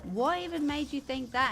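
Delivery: mock-astonished questioning; incredulous retort. Retold incredulous reply in argument.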